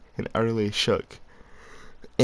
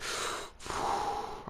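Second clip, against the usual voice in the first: long, soft sigh out; a deep breath out, resigned; 10 dB quieter than usual. Real, not acted. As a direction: let out a heavy breath before answering. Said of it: "I took a deep breath".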